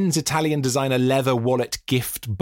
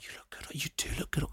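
soft whisper, no voice; whispered compliment; 15 dB quieter than usual. Real, not acted. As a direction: breathe the words "You look good".

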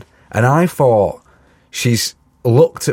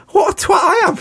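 self-mocking yell. Realising he blanked the woman calling his name, mock shout. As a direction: yell at yourself for being an idiot.

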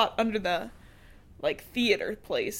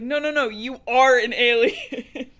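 laughing insistence; 4 semitones higher, 6 dB louder than usual. Retold or imitated. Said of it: "she's like".

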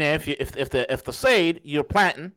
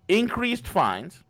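ordinary talk

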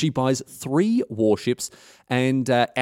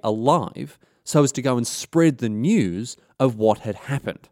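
normal talking voice

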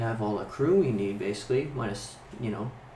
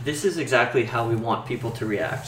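usual speaking voice

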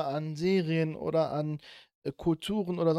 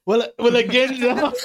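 loud, high and laughing; thrilled, laughing goosebumps; excited; 9 semitones higher, 7 dB louder than usual. Real, not acted. Excited after singing. (aufgeregt und lachend)

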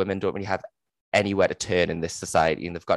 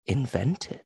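higher, softer puzzled question; a hint of the old mother's surprise; mock dying mother; 4 semitones higher, 4 dB quieter than usual. Dying mother questioning her daughter, soft. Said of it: "the mother's like".

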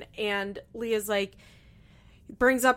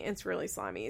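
quiet repeat; 2 semitones lower, 8 dB quieter, faster than usual. Shown as a demonstration.

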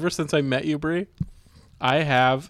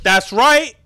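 mock tough backup; 6 semitones higher, 5 dB louder than usual. Backing up Brie Larson in improv.

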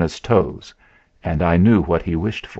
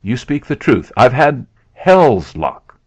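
higher exclamation, louder and slower at the end; crying out; 5 semitones higher, 4 dB louder than usual. A narrator's reading. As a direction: build to a loud, drawn-out finish. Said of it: "he cried".

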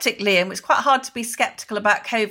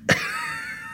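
laugh; 6 dB quieter than usual. Real, not acted.

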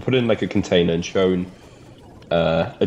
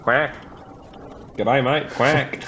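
Australian duck accent; 5 semitones higher, much slower than usual. Shown as a demonstration. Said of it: "What about an Australian duck?".

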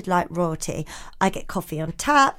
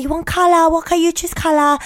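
mock Vietnamese accent; 6 semitones higher, 3 dB louder than usual. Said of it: "they'd be like".

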